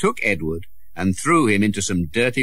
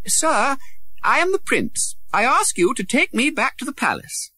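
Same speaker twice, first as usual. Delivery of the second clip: put-on high boy-prince voice calling to a rider. A narrator's reading. Prince calling to a passing gentleman, high and proud. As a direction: call up to the horseman, proud but young. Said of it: "Edward called out to him".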